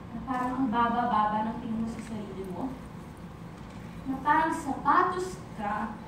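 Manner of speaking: low, soft, halting hurt; wounded confession. Acted. Describing feeling small and discarded. (mababa at nasasaktang tinig)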